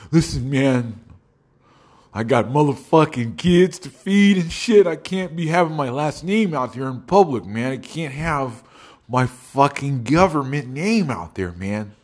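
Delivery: drawn-out, pleading protest in a put-on voice; Randall. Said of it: "this is what he's gonna sound like".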